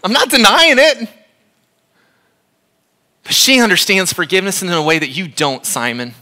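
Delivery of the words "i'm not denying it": high, loud voiced admission, much higher; mock woman's voice. Preacher voicing the forgiven woman, high and loud. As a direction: play her owning it openly, pitched high and loud. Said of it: "she's like".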